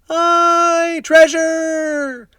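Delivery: very high, loud crew shout; pirate shouting. A narrator's reading. A crewman shouting about the treasure, high loud cheer. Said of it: "the other shouted".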